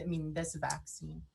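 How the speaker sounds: usual speaking voice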